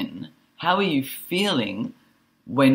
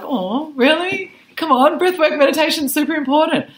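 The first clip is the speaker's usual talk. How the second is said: mock disbelief; 6 dB louder than usual. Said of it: "And I was a bit like".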